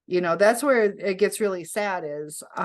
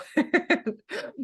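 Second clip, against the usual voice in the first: a sudden high burst of laughter; 10 semitones higher than usual. Real, not acted. Reacting to the scammer's reply, real high laugh.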